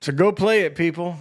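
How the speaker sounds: loud and high to the crowd; calling out. Real, not acted. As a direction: shout it out to everyone. Calling out to viewers.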